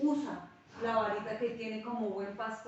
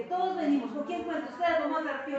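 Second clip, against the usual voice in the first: urgent plea; 5 semitones higher, 5 dB louder, faster than usual. Retold or imitated. Retelling her plea to pray.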